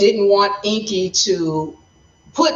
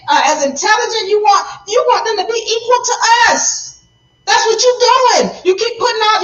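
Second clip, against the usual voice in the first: much higher, rising angry rant; upset Enlil; 6 semitones higher than usual. Retold or imitated. Enlil berates Enki for sharing their DNA, higher and angry. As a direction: lay into him, building to a yell. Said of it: "Enlil was upset with Enki".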